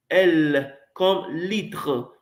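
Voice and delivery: "L comme litre" said very slowly and clearly; careful slow demo of "L comme litre"; slow model pronunciation. Shown as a demonstration. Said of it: "Répétez après moi".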